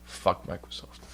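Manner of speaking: soft unvoiced Fuck Microsoft; whispered curse. Real, not acted. Streamer muttering his grudge, whispered. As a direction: breathe it out as an aside. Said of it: "whispered repeat".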